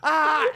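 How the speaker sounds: high yell through a stiletto in the mouth; muffled yell. Retold or imitated. Comic reenacting yell with a stiletto shoved in mouth. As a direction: yelp around the shoe in your mouth. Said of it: "so I'm like".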